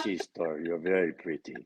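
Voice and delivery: put-on low, soft Transylvanian accent; character's Transylvanian accent. Actor slips into character for a delighted interviewer, Transylvanian accent. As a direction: purr it quietly in a Transylvanian accent. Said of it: "that's an accent that was given to me to do".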